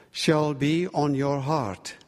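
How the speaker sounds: usual speaking voice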